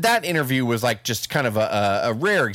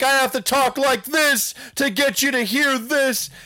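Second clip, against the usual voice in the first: much higher, louder, slow, drawn-out Rock voice; The Rock impression; 14 semitones higher, 4 dB louder than usual. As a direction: ham it up as The Rock, slow and booming. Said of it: "And even The Rock was like".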